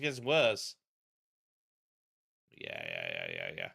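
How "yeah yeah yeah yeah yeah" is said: very low, quieter mock agreement; deep voice. To viewers, droning yeah in a very low voice.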